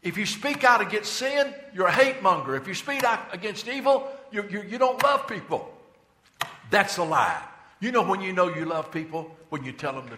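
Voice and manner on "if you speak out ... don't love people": loud, high scolding voice of the secular critics; mocking the critics. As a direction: throw their hushing words back at them. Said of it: "And so they said".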